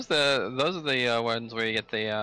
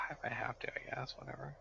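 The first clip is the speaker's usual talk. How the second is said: grudging, breathy whisper; reluctant whisper; 12 dB quieter than usual. Acted. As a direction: whisper it like you're giving in. Grudgingly agrees to join group.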